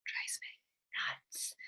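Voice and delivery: slow exasperated whisper. Real, not acted. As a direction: breathe it out like a confession. Host asks about coaches stuck.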